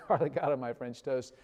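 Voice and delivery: ordinary delivery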